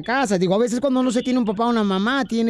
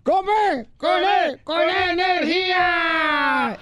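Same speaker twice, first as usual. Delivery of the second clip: shouted catchphrase; 6 semitones higher, much slower than usual. Acted. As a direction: hype it up like a radio host.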